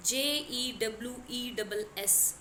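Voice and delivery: careful slow dictation of letters; slowly spelling the word out for students. Shown as a demonstration. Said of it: "ये बन गया juice".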